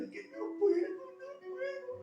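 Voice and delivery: put-on a sobbing young woman's broken voice, very high; imitating a crying girl. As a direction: mimic the girl weeping, voice pushed way up. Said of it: "Y la muchacha llorando".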